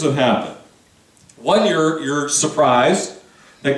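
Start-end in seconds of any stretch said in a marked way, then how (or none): none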